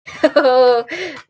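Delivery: real amused laugh. Real, not acted. Reader laughs at Watson being proved wrong, amused.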